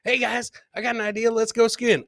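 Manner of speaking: usual speaking voice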